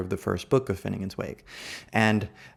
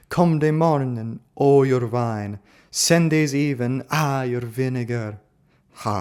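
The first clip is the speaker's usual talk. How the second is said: hammed-up Eastern European accent, raised and punchy; faux Romanian accent; 3 semitones higher, 4 dB louder than usual. Lecturer reciting Finnegans Wake to a class, faux Eastern European accent. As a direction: read it out in a mock Romanian accent. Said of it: "sort of faux Eastern European, kind of Romanian accent".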